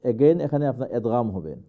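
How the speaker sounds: ordinary delivery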